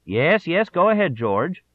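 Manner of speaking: high, loud telephone reply; Johnson on the phone. A narrator's reading. Johnson answers Parr's election-night call loudly. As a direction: answer a bad line eagerly. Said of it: "act out his own role".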